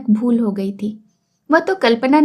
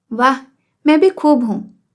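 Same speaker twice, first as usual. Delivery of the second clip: surprised. A narrator's reading. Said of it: "चकित होकर उठ खड़ी होते हुए बोली".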